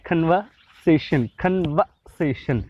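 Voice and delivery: slow कन्वर्सेशन, no R, breathy K; British conversation. Shown as a demonstration. Teacher slowly repeating the British कन्वर्सेशन for students. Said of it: "खे की आवाज़ निकालते हैं".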